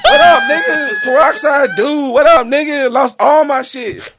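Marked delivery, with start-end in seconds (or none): 0.0-1.0 high, hollering through a laugh; laughing holler of a made-up hero; laughing shout. Real, not acted. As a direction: yell the greeting while cracking up. Mock greeting as peroxide man.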